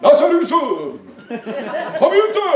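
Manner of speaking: slow, high sing-song imitation; hammed-up mild summons, stretched out; mock-gentle coaxing. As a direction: draw the words out in a coaxing mock call. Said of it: "Han kunne jo sagt".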